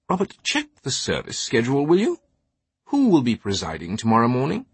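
scheming British aristocrat, lifted pitch; highbrow British accent. Acted. Scheming scholar prompting his companion, highbrow British accent. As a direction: highbrow British, lightly sly and leading. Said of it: "his accent a highbrow Saxonesque British".

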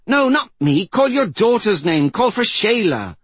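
high, urgent shouted correction; frantic shout. A narrator's reading. Mystic telling mother to call her daughter, frantic shout. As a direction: cry out instructions in desperation, pitched very high.

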